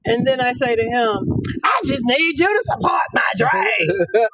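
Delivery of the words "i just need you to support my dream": slow, shouted dramatic plea. Retold or imitated. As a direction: belt it out like a hurt dreamer. Wife pleads, shouted.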